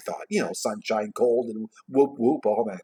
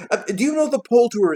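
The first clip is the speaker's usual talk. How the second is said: high, quick eager question; eager Scrooge; 13 semitones higher, 7 dB louder than usual. A narrator's reading. Scrooge asking the lad below, eagerly. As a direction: fire the question down, loud and brisk.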